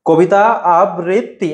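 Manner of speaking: slow, separated syllables in আবৃত্তি; slow segmented model of আবৃত্তি; piece-by-piece pronunciation. Shown as a demonstration. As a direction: break আবৃত্তি into ab-rit-ti. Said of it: "খণ্ড খণ্ড ভাবে উচ্চারণ করবে".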